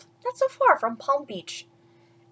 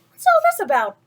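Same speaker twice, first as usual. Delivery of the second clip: higher, louder crowd cry; shouting; 10 semitones higher, 6 dB louder than usual. Acted. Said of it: "the people came shouting".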